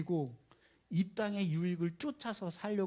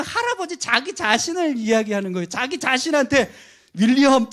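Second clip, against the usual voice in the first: higher, much louder, punching the reveal; excited loud explaining; 6 semitones higher, 11 dB louder than usual. Real, not acted. Preacher revealing the joke to the congregation, loud and excited. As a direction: drive the punchline home to the congregation.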